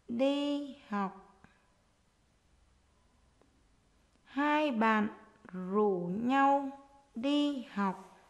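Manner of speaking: dictating. Shown as a demonstration. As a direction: dictate word by word to young pupils. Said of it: "hãy nghe cô giáo đọc để chúng mình viết".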